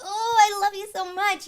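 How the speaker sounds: high, loud, gushing squeal of love; gushing pet affection. Acted. Owner squeezing their pet, gushing with love. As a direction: gush as if squeezing a beloved pet. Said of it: "like you're squeezing your pet".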